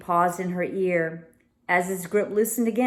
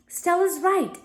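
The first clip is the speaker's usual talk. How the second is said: higher, sharp angry interruption; angry cut-in; 11 semitones higher than usual. A narrator's reading. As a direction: break in hotly to defend her friend. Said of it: "cut in an angry voice".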